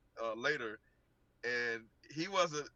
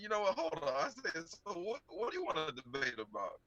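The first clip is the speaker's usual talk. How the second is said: retold incredulous reply; 3 semitones higher than usual. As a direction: replay your own taken-aback comeback, pitched up. Retells own startled reply to challenger.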